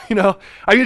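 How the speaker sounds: ordinary talk, nothing marked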